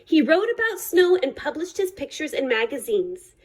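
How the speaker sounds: ordinary delivery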